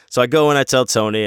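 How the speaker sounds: ordinary talk, nothing marked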